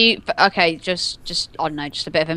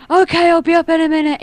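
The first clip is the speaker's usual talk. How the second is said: calling out; 10 semitones higher, 4 dB louder than usual. Retold or imitated. Calling out to fake parent.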